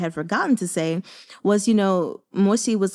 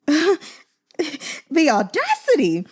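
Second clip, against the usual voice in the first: outraged disbelief; 8 semitones higher, much slower than usual. Retold or imitated. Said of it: "I was like".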